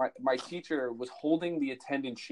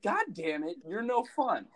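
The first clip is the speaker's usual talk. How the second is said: higher-pitched retold exasperation; mock frustration; 8 semitones higher than usual. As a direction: complain in mock defeat, voice rising high. Retold letdown at unflappable teacher.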